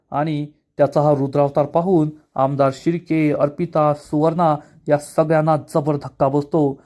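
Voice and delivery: ordinary talk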